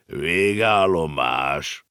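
very slow drawn-out call like a conductor; horse announcing. A narrator's reading. The horse announces the last stop: slow drawn-out call. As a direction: stretch it like a station announcement. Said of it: "így szólt".

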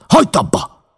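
high, loud cry; stop, shouted up high. A narrator's reading. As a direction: scream it to stop him. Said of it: "kiáltott rá".

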